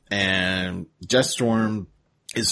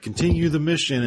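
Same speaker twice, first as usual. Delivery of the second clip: mock heroic line; 9 semitones higher than usual. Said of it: "And Cheetor's like".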